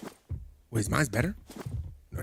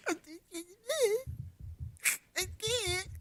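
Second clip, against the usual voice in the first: whimpering fear; 10 dB quieter than usual. Real, not acted.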